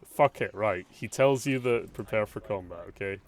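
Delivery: usual speaking voice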